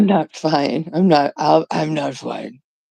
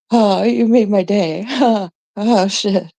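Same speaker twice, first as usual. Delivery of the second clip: normal talking voice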